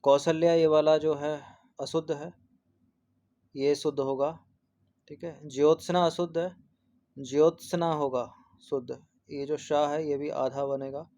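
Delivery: ordinary talk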